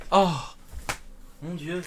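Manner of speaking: straining through push ups; talking while doing push ups. Real, not acted. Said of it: "je fais des pompes".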